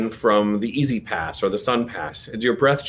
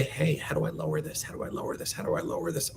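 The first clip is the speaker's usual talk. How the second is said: softer each time until whispered; fading to a whisper; 4 semitones higher, 10 dB quieter than usual. Shown as a demonstration. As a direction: let it fade down to a whisper. Voice lowered as asked, to whisper.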